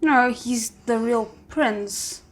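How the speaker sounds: ordinary talk, nothing marked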